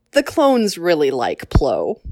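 usual speaking voice

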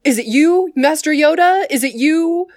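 bright helpful alien asking who to call; polite Kaminoan. Retold or imitated. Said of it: "Lama Su is like".

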